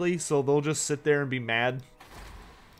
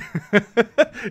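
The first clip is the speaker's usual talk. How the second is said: loud laugh; 7 semitones higher, 8 dB louder than usual. Real, not acted. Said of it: "It's funny".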